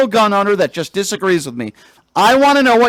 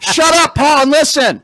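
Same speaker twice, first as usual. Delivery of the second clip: sharp, loud and higher; 5 semitones higher than usual. Real, not acted. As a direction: bark it at him. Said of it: "why are you yelling?".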